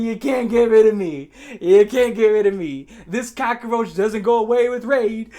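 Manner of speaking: loud, drawn-out triumphant boast; hyping himself up. Retold or imitated. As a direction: crow it to a cheering crowd. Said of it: "I'm just hyping myself up".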